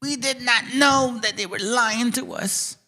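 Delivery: high mocking voice, slow and dragged out. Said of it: "Cracker Barrels are like".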